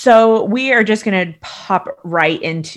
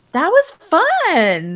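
sister's grudging praise; 10 semitones higher, 4 dB louder, much slower than usual. Retold or imitated. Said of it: "she was even like".

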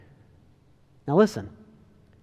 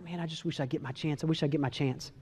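soft, low aside; quiet, lowered inner thought; retold wistful wish; 4 semitones lower, 8 dB quieter than usual. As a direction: wish privately under your voice. Peter wishes privately, in retelling.

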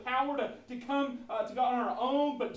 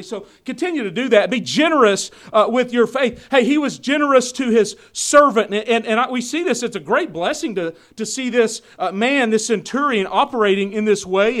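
loud, driving sermon delivery; booming pulpit voice; full-force preaching; 16 dB louder than usual. Acted. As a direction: preach it out to the congregation.

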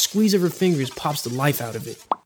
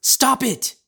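sharp, higher mother's cry; mother stopping her son scratching, sharp cry; mom cutting in; 10 semitones higher than usual. Retold or imitated. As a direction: cut through the panic like a mom. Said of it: "My mother's voice breaks through".

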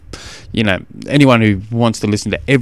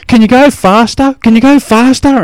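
high, loud impatient shout; yelling kid; 12 semitones higher, 6 dB louder than usual. Retold or imitated. Son yelling at dad in charity ride, loud and high. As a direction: hurry dad up from the back. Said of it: "he's yelling at me the whole time".

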